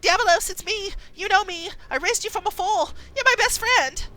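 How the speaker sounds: high, insistent plea; pleading Imogen. Acted. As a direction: beg the horse to remember. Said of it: "And Imogen says".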